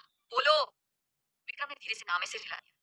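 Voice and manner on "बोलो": sharp, high, loud "बोलो।"; loud command. A narrator's reading. Angry father demands an answer, loud shout. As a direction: bark the order at him. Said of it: "Kabir ने तेज आवाज में कहा".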